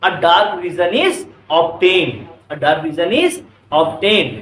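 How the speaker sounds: every word stretched out for writing down; slow dictation. Shown as a demonstration. Teacher dictating a definition to the class, very slowly. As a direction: dictate slowly so students can copy.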